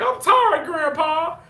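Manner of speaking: high, whiny mock complaint; imitating coworker's meme quote. Girl at work says the meme line, imitated high whine. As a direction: mimic a girl playing a tired grandchild. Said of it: "so this girl said, she's like".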